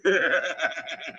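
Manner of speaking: high cackling chuckle, trailing off; mocking laugh. Real, not acted. Streamer mocking his critics' behaviour, derisive laugh. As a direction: laugh at how they behave. Laughs at how they represent.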